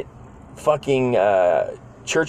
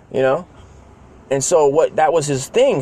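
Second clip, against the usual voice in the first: ordinary talk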